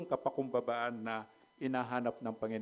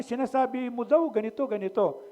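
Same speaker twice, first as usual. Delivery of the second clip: higher and louder mocking accusation; mock accuser; 8 semitones higher, 4 dB louder than usual. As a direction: jeer like the accusers of Pablo. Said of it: "Na sabi". (nanunuyang boses ng nag-aakusa kay Pablo, mataas at malakas)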